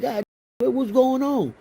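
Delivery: high, shocked voice of a jumped man; imitating a startled man; startled victim. Victim startled, acted quote.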